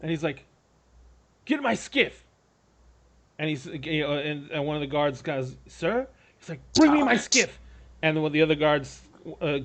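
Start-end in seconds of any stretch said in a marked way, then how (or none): 1.5-2.2 high-pitched command, falling at the end; urgent order. Acted. Lord orders his guards into action, urgent. 5.7-6.1 higher, hesitant query; questioning guard. Acted. Guard unsure of the lord's order, questioning. 6.7-7.6 high, loud shouted order; insistent command. Acted. Lord repeats his order to a guard, insistent shout.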